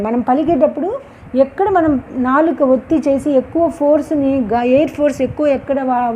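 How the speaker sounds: ordinary talk, nothing marked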